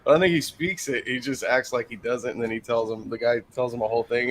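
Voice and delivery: normal talking voice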